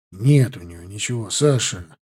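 low, understated sigh of resignation. A narrator's reading. Martynov to Sasha over the compass check, sighed out. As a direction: let it out low, on a breath. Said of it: "выдохнул Мартынов".

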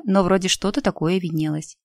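plain reading voice

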